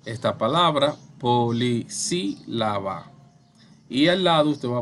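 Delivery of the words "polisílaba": "polisílaba" split into syllables with short gaps; spaced-out "polisílaba", one syllable at a time; syllable-by-syllable demo. Shown as a demonstration. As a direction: sound out "polisílaba" piece by piece. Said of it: "usted divide".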